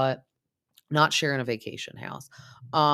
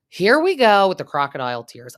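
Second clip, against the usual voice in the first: exasperated; 4 semitones higher, 10 dB louder than usual. Real, not acted. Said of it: "exasperated comment".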